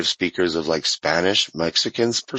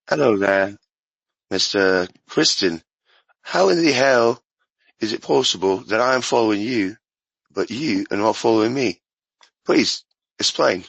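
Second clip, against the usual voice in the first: put-on British accent. Shown as a demonstration. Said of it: "talk to 'em in their own accent".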